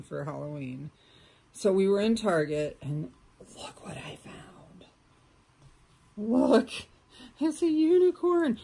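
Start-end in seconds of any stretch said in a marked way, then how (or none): 3.6-4.4 whispered reveal to the viewers; excited whisper. Real, not acted. Reveals her Target find to viewers. 6.1-8.6 high, loud delighted show-off; excited reveal. Real, not acted. Shows off the unicorn onesie.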